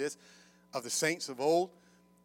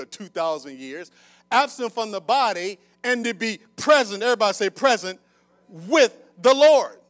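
raised, loud sermon delivery with punched key words; forceful preaching; 6 semitones higher, 12 dB louder than usual. Real, not acted. Preacher quoting Paul to the congregation, forceful. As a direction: preach it out to the whole room.

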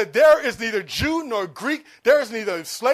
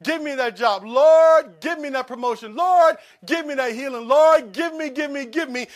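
pleading Lord give me, louder and higher; hammed-up begging prayer; mock whiny begging; 4 semitones higher, 7 dB louder than usual. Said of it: "you come to me weeping and crying".

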